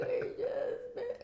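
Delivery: high, squeaky mock sob; hammed-up tearful whine; imitated crying. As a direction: whimper it in a tiny high voice, half laughing. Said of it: "I'm like crying".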